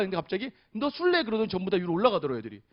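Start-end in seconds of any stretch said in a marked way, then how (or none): 0.7-1.2 put-on teasing kid. Kids tease at playground.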